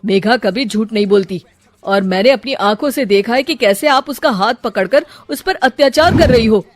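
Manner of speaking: loud, firm rebuke; angry son. Acted. Son scolding his mother, loud and firm. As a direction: put his mother in her place.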